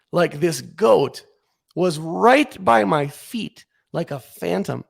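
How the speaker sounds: ordinary delivery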